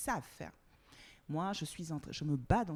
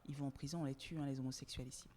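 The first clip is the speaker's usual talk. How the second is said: lowered voice; 3 semitones lower, 9 dB quieter than usual. Real, not acted. Confiding taboo topic, lowered voice.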